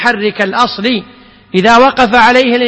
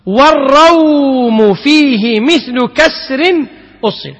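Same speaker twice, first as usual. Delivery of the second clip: slow verse recitation; 2 semitones higher, much slower than usual. Shown as a demonstration.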